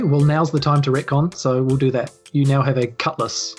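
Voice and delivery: usual speaking voice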